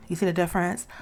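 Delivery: normal talking voice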